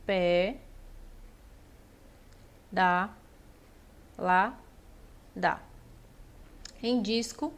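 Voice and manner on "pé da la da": isolated syllables with long pauses; separação silábica. Shown as a demonstration. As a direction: dictate one syllable at a time, low-pitched. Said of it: "Então, a gente separa". (sílaba por sílaba, com pausas longas)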